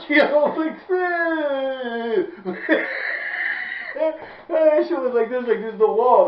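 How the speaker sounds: high, loud words through helpless laughter; laughing hard. Real, not acted. Showing viewers the muddy wall, high laughing. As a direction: crack up while describing it.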